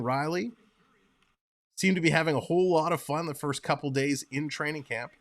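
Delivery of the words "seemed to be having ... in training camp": ordinary delivery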